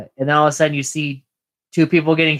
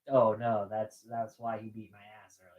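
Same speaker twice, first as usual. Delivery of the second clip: dismayed mutter; 5 semitones lower, 13 dB quieter than usual. Retold or imitated.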